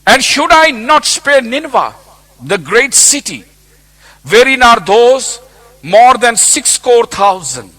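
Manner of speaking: high, loud, slowing on the last words; full-force preaching. Acted. Preacher voicing God's question about Nineveh, loud. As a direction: let God's question ring out.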